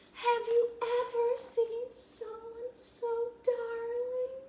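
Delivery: very high, slow singsong; cooing. A narrator's reading. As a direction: sing it out to the darling piglet. Missus Watson coos over piglet.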